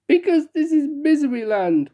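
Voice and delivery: high, tearful wail; weeping. Acted. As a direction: cry it out like a heartbroken king. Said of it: "wept the king".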